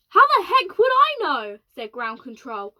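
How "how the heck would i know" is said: much higher, louder outburst; exasperated. Acted. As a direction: throw up your hands. Exasperated ground control.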